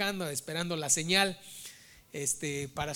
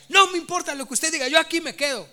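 loud, high-pitched retold argument; imitating yelling; 7 semitones higher, 8 dB louder than usual. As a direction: imitate a stubborn shouted refusal. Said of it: "Estaba gritando". (imitando gritos de terca protesta)

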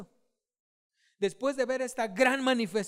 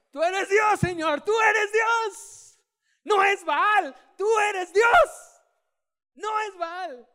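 jubilant shout; 9 semitones higher, 11 dB louder, slower than usual. Retold or imitated. Preacher voicing jubilant people.

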